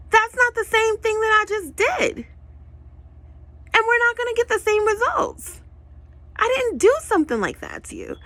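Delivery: high, loud indignant protest; indignant outburst. Acted. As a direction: shriek the objection at the copycats. Voiced indignant retort to mimics.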